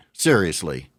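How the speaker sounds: ordinary delivery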